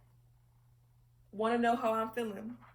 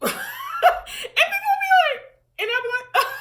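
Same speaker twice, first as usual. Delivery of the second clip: high, loud, helpless laughter through the words; laughing hard; 13 dB louder, faster than usual. Real, not acted. Telling how people reacted to her news, cracking up loudly. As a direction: crack up too much to finish the sentence.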